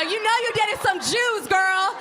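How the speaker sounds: put-on high, sassy girlfriend talk, drawn out. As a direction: play the sassy bestie calling her out. Addressing audience member, sassy.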